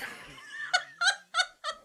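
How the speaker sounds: squealing high-pitched laugh; cracking up at her own failed accent. Real, not acted. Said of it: "I'm just gonna stop it now".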